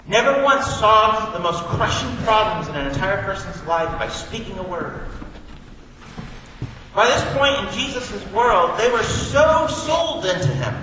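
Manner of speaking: high, loud, rising preacher's voice; full-force preaching. Acted. Pastor preaching to the congregation about Jesus, full force. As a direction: preach it out to the back pew.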